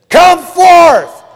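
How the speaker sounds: huge, high-pitched bellow of command; Christ's commanding shout. Retold or imitated. Preacher imagines Christ calling all the dead, thunderous shout. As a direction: shout it as if to raise every grave. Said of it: "called Lazarus and said".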